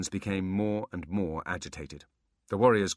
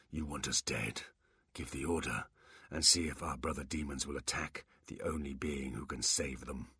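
bored; 3 semitones lower, 4 dB quieter than usual. Acted. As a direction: dismiss the posturing wearily. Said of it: "Quan was getting bored".

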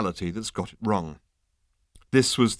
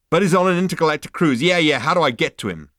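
fast, loud, higher cut-in; impatient Zaphod; 7 semitones higher, 7 dB louder, faster than usual. Acted. Zaphod cutting off the receptionist, fast and impatient. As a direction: rush over her words.

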